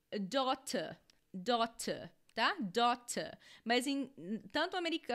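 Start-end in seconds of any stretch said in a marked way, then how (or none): 0.1-2.1 British "daughter", crisp t and no final r; English-accent "daughter" with a true t. Shown as a demonstration. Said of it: "na Inglaterra eles falariam". 2.6-3.4 British "daughter", crisp t and no final r; English-accent "daughter" with a true t. Shown as a demonstration. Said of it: "na Inglaterra eles falariam".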